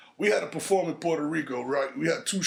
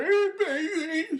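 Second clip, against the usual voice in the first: high, slow sultry come-on; mock flirty woman; 12 semitones higher, slower than usual.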